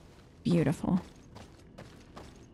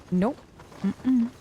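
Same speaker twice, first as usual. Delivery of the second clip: ordinary talk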